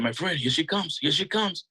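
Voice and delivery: high, urgent little-man voice; the little guy nudging him in broken English, excited. Retold or imitated. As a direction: hiss it out like a sidekick spotting her.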